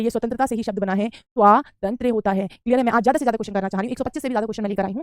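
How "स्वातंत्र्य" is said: स्वातंत्र्य slowly in parts, "svaa-tan-trya"; careful pronunciation. Shown as a demonstration. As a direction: draw out the long "aa" in स्वातंत्र्य. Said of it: "आप कहेंगे स्वातंत्र्य".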